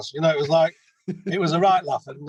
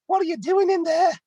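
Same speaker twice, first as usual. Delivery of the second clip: much higher mock neighbour asking; crappy put-on accent; 13 semitones higher than usual. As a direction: squeak it up as the curious local. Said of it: "Sorry about my crappy accent".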